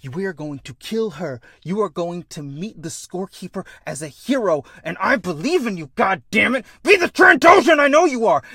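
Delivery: from firm resolve to a high full shout; acted heroic plea swelling to a yell; building rallying speech. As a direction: rouse a warrior to battle. Kid's rallying speech climax.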